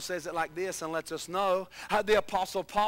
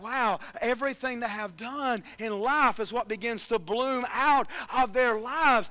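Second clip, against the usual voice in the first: preaching; 3 semitones higher, 5 dB louder than usual. Acted. Preaching builds again.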